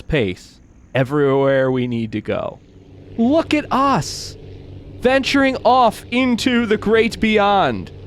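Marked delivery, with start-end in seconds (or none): 3.2-7.9 grand wonder. Pretend. Plane drifts off slowly.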